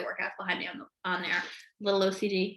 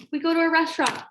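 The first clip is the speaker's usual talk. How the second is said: higher, emphatic restatement; mock-momentous repeat; dramatic announcement; 7 semitones higher, 3 dB louder, faster than usual. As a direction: announce it like a looming crisis. Repeats line as a dramatic event.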